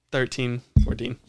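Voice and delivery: ordinary delivery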